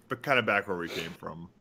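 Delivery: usual speaking voice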